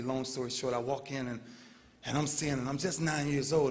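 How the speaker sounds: ordinary delivery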